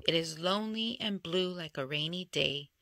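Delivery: usual speaking voice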